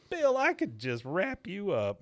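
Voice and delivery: high, slow imitated female voice; imitated affectionate woman. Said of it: "She goes".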